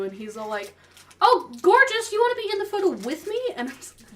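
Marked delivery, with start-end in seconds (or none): 1.2-3.4 much higher and louder, showy and fast; gushing actor voice. Retold or imitated. Actor inviting fan into photo, higher, louder, gushing. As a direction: sweep the fan up like a flamboyant host.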